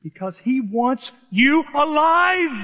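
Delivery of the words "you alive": high, loud and drawn out; stretched, rising climax; emphatic shout. Real, not acted. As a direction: preach it out slowly at full voice.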